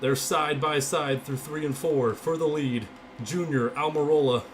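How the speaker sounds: loud race commentary; urgent play-by-play, voice pushed up; live play-by-play. Real, not acted.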